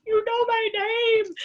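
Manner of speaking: high, slow, tearful quote; replaying her own sobbing disbelief; retold bawling. Retelling meeting her idol, tearfully high. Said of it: "And I literally started bawling".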